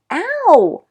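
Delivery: very high, loud yelp; sharp yelp of pain. Acted. Patrick as Omri squeezes the bullet out, yelping.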